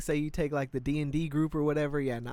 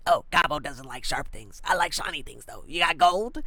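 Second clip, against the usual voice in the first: a hint of a greedy goblin talking about himself. Goblin cosplayer answering the stall worker in a light goblin voice. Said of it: "And I was like".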